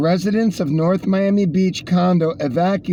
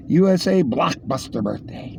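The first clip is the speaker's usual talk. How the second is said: normal talking voice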